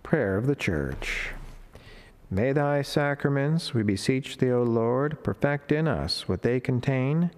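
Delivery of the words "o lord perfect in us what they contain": ordinary talk, nothing marked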